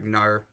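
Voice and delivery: "Nar" with an Australian r-coloured glide; Australian vowel drawn toward "Nar". Shown as a demonstration. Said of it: "the Australian thing".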